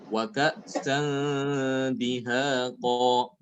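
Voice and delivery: slow level chant, nasal held in "ka'san"; ikhfa before dal. Shown as a demonstration. Teacher gives a dal example, slow recitation. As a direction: stretch "ka'san" and long vowels of "dihaqa". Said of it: "bertemu dengan huruf dal ya".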